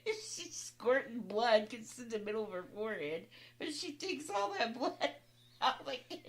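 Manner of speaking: high, giggly telling; laughing. Real, not acted. Sister telling the others about the ketchup mix-up, laughing. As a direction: barely hold the laughter in while talking. Cracking up at her own story.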